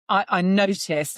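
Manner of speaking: ordinary delivery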